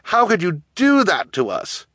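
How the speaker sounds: high, reproachful call. A narrator's reading. Betrayed orphan boy to guardian above, calling reproachfully. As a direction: call it up, pained and accusing. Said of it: "Klaus called up to her".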